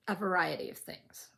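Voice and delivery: ordinary delivery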